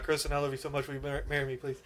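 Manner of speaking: nervous proposal blurted out fast; rushed blurt. Retold or imitated. Fiancé proposing to girlfriend after spacing out, rushed blurt. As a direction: rush the words out in one breath. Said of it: "Pretty much that fast".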